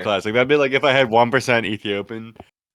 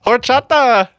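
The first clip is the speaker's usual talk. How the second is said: high, loud fake-Spanish cry; mock Spanish shout; 9 semitones higher, 8 dB louder, slower than usual. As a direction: shout it in a hammed-up Spanish accent. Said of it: "Alec Baldwin's wife in the background is like".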